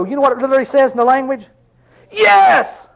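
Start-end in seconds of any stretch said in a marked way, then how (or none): 2.1-2.9 Yes! shouted much higher and louder; emphatic yes. Shown as a demonstration. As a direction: burst out Yes! with full emphasis.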